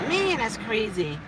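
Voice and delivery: normal talking voice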